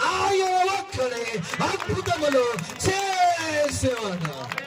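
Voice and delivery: high, slow shouted proclamation; loud declaration. Shown as a demonstration. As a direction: proclaim it to the back of the hall. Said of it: "ఇలా గట్టిగా చెప్పండి".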